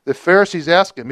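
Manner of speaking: usual speaking voice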